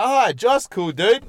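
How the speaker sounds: loud, high eager hello; excited greeting. Retold or imitated. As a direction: greet your mate all pumped up. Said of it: "I was like".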